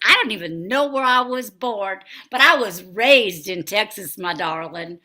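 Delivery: light put-on Texas accent. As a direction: play a chatty Texas belle. Is my accent bothering you.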